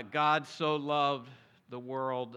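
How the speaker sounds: slow, deliberate reading of the verse; slow solemn scripture. Shown as a demonstration. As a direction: lay each word down slowly. Quoting verse slowly.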